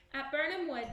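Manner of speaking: usual speaking voice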